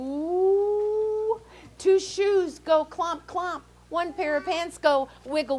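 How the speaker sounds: high, slowed chant of the refrain; high spooky refrain. A narrator's reading.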